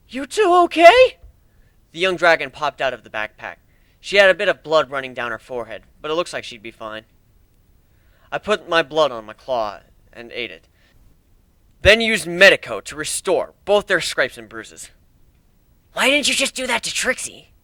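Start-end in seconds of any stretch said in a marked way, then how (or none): 0.1-1.2 high, slow voiced question; concerned call. Checking on allies. 15.9-17.5 put-on lightly higher child-like question; a young dragon asking. Dragon character asks.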